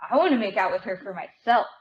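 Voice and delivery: ordinary talk, nothing marked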